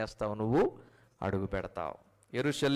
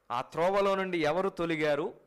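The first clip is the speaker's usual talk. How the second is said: ordinary talk, nothing marked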